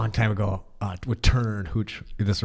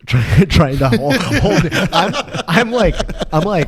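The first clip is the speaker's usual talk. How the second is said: laughing; 7 semitones higher, 7 dB louder, slower than usual. Real, not acted. Stifling laughter at the girl.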